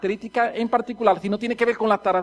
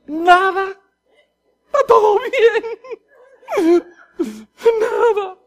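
high, strained voice holding in anger; comically tense denial; forced calm; 11 semitones higher, 5 dB louder, much slower than usual. Acted. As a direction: squeeze it out while bottling up a scream. Acts suppressed anger, claiming all's fine.

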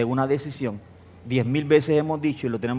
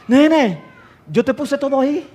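higher voice, a laugh in the first word; a light take on the wife's reply; voicing the wife; 10 semitones higher, 4 dB louder than usual. (imitando a la esposa con voz más aguda)

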